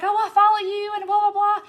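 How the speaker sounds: high, loud retold gush; mock starstruck babble, loud and high; excited fan gushing. Quotes the thrilled fan gushing.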